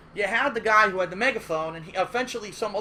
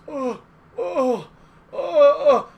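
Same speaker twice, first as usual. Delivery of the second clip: higher, loud stunned groans; speechless; 6 semitones higher, 8 dB louder, much slower than usual. Retold or imitated. Bleeding player lost for words, stunned groans. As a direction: stammer in shock, no words. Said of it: "like he can't even speak".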